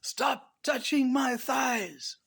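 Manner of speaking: high, drawn-out mock scream; loud, high-pitched hammed-up shriek; screaming lady. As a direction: scream it slowly like an outraged lady. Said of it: "to have a lady screaming".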